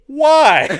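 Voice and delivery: high, loud, outraged why; mock the guy's nerve; incredulous shout. Worker mocking the customer's nerve, loud incredulous. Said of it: "has the audacity to go".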